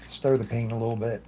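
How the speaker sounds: ordinary delivery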